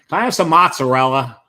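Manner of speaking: hammed-up non-Italian deli order; mock accentless customer, blaring every vowel.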